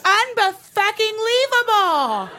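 high, drawn-out indignant exclamation; acted outrage. Girlfriends react to drunk man's request, high slow outrage. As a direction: stretch the word out in hammed-up disgust.

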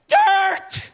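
one high squeak of a word; squeaky high blurt. Retold or imitated. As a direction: chirp it out like a tiny eager voice. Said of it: "And I'll be like".

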